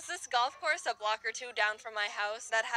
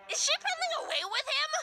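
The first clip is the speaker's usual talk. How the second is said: shocked lady; faster than usual. Retold or imitated. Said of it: "point of view of this lady".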